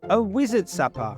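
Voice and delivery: usual speaking voice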